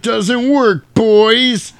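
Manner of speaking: high, loud jeering shout; gloating villain taunt. Retold or imitated. Paul mocks the brothers' garlic bath, gloating shout. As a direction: jeer it out like a triumphant villain. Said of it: "He's like".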